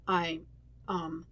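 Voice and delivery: faltering, trailing off into um; stumbling. A narrator's reading. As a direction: lose the thread mid-word. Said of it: "Zuko stumbles through his words".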